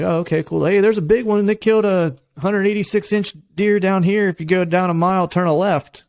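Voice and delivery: chirpy helpful local, eager to share; friendly local. Retold or imitated. Guest imitates a Kansas local pointing him to a big deer, high and upbeat.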